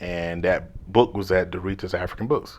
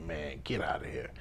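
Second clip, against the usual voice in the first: muttered brush-off; 4 semitones lower, 15 dB quieter than usual. Retold or imitated. Brushing off uncle's trash talk.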